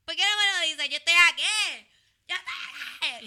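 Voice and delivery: high, loud, outraged retort; indignant shout. Retold or imitated. Woman confronting the man who ignored her, loud indignant shout. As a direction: shout it back, furious at being ignored. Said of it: "Y yo me digo que".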